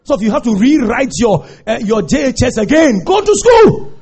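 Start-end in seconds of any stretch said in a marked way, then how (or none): 3.0-3.9 fiery preacher build; preaching at full force. Acted. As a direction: preach it to the back row.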